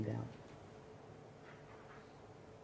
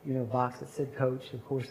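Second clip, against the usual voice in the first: ordinary talk, nothing marked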